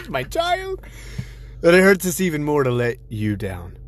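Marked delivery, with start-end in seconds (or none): 0.1-0.8 high mock cry. Mock wail over child.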